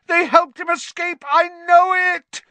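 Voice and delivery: very high, loud, frenzied howl; Snape howling. A narrator's reading. Professor accusing students of aiding escape, howling. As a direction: howl the accusation, beside yourself. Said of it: "Snape howled".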